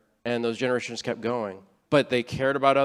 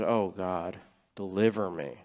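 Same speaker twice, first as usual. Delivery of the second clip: lower, softer, sincere plea to God; heartfelt prayer; 6 semitones lower, 7 dB quieter, slower than usual. Retold or imitated. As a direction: pray it quietly, truly meaning every word. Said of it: "And from my heart".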